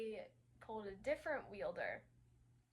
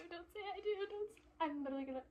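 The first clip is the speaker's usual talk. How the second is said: higher, urgent rushed begging; panicked pleading; 5 semitones higher, faster than usual. Real, not acted. Reader begging the character not to say I do, panicked. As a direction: plead fast as it happens.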